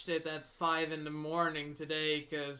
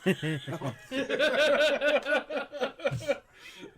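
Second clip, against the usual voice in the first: loud, high burst of laughter; helpless laughing at a silly phrase; big laugh; 9 semitones higher, 7 dB louder than usual. Real, not acted. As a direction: crack up in a long cackling fit. Laughing at male brother slip.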